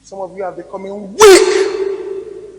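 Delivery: loud and slow, every word hammered; thundering rebuke. Real, not acted. Preacher rebuking the congregation for dropping God, thundering slowly. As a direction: thunder it at the weak-hearted.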